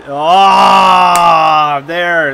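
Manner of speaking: loud exasperated ah there; loud annoyed groan; frustrated groan. Real, not acted. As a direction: groan out at the bad call. Gamer groans at penalty.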